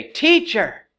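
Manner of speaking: high-pitched call of "Teacher"; imitated cry to a teacher. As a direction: call out to the master for help. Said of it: "look at how they cry out to him".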